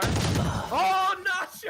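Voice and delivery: high, loud burst of hype; excited shout. Real, not acted. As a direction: yell the name as the clutch lands. Caster erupts at clutch play.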